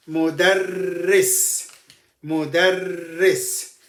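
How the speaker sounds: Mudarris said slowly with a held double rr; slow and careful. Shown as a demonstration. Teacher modelling the word slowly for the class. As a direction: spell Mudarris out slowly with your voice. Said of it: "This is how we pronounce it".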